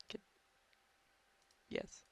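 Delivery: ordinary delivery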